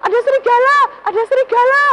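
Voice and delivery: put-on terrified kid goat yelling for help; screaming for help. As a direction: shriek for help as the little goat. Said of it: "anak kambing itu segera berteriak".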